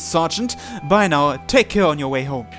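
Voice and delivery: ordinary talk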